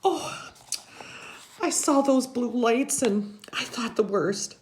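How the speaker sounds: high, shaky-free fretting grandmother; grandma's worried voice. A narrator's reading. Grandma fussing over the soaked boy, high and anxious. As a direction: voice her high and anxious, like an old lady. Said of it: "she's saying".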